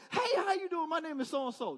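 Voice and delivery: eager conversation opener, starting high; friendly ice-breaker greeting. Acted. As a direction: introduce yourself brightly to break the silence. Acts out greeting strangers at table.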